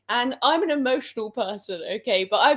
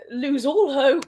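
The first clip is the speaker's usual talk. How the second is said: ordinary delivery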